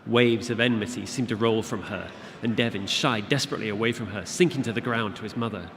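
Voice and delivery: plain reading voice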